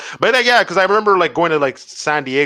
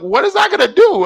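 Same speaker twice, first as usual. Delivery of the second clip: much higher, louder disbelieving retort; exasperated disbelief; 5 dB louder than usual. Retold or imitated. Traveller to airport guard, higher and louder in exasperated disbelief. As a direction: throw up your hands, what's the point. His baffled retort at security.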